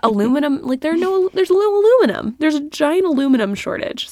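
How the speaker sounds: rising to a high, loud peak; excited. Real, not acted. Amazed at the aluminum shortage.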